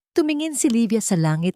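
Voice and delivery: ordinary delivery, nothing marked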